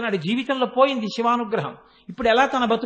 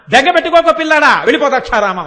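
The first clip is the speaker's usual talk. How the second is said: loud reassurance; 3 semitones higher, 7 dB louder than usual. Retold or imitated. Goddess to weeping child Vyasa, loud reassuring send-off. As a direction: call out comfort, then send him off.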